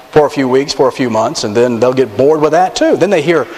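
ordinary delivery

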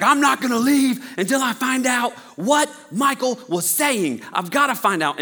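comic insistent shout; determined yell. Acted. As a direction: holler your stubborn vow. Joe demanding outside the house.

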